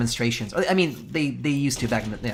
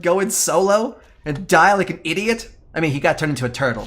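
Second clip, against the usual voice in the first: normal talking voice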